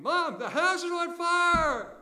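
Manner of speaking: very loud, high, drawn-out scream; screamed alarm. Acted. Daughter seeing smoke, warning her mom, screaming. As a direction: scream it to rouse the whole house. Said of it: "and she screamed".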